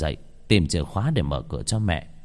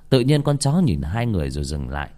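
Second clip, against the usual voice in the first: plain reading voice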